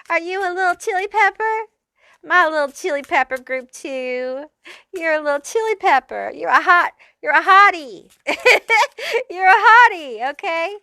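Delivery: high, cutesy teasing voice with giggles; playful teasing. Real, not acted. Reader teasing the viewers as little chili peppers, cutesy and giggly. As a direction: coo at them like a sweet little pet.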